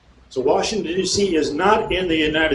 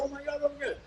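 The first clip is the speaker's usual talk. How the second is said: high, breathy mock panic, much higher and far softer; 11 semitones higher, 15 dB quieter, much faster than usual. Mocking legislators who cave on federal land, high mock panic. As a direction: whimper it like a spineless politician caving in. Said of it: "They go".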